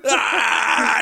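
high, loud "aah" outburst; loud mock shriek of realization; imitated laughing scream. Dave cracking up after realizing prank.

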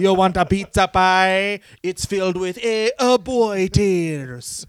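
cartoon Italian accent; Pat Patterson. Wrestler offering a pizza pie, in a silly Italian accent. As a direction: offer it in mock Italian, dragging the ending.

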